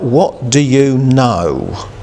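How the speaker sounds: "What do you know" slowly, each word weighed; slow emphatic question. Shown as a demonstration. Preacher pressing the congregation with the question, slow and weighty. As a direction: let the question hang, very slow. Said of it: "the emphasis of that question".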